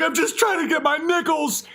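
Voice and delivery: high pleading whine; whiny bear. Retold or imitated. The beaten bear begging for his nickels, high whine. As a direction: plead pathetically for the coins. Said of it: "He's like".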